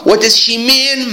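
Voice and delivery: high question pitch held through "mean". Shown as a demonstration. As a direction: keep "mean" up high as you ask. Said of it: "تون تون".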